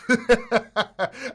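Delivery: real burst of laughter; loud amused laugh. Real, not acted. Laughs at own impulse buy.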